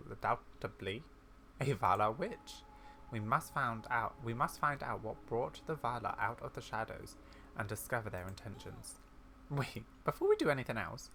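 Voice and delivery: ordinary talk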